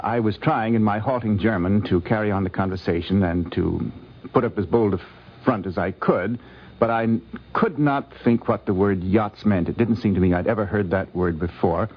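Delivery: ordinary talk, nothing marked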